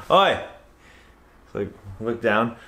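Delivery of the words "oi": Australian cop shout. Retold or imitated. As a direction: call out to stop someone.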